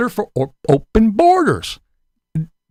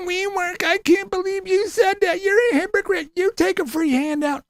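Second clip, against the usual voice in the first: mocking whiny liberal; 9 semitones higher than usual. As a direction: mimic a whiny opponent in a high put-on voice.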